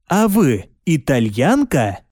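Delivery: ordinary delivery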